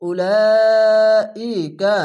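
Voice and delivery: Quran recitation. Shown as a demonstration. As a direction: hold the vowel for four counts, ringing out. Four-count madd stretch recited. (চার আলিফ টেনে জোরে তিলাওয়াত)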